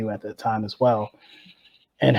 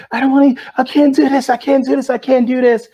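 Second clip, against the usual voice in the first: high, loud panicked wail; pleading, on the verge of tears; crying; 12 semitones higher, 7 dB louder than usual. Retold or imitated. Scared teammate in the boat, high tearful panic. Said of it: "started crying".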